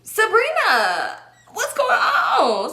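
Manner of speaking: high, slow, stretched call of her name; high put-on voice. Mock-calling Sabrina across the party, high and drawn out.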